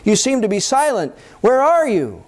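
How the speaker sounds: high, agitated mock prayer; worked up. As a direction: demand answers from God, pitch climbing. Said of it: "we get all worked up".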